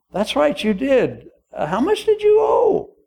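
high incredulous king, rising to a shout; hammed-up king voice. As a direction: quiz him like a mock-astonished king. King questions servant.

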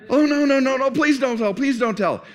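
high, loud panicked begging; pleading. Acted. Boy begging his sister not to tell, panicked. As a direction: beg like a scared little boy.

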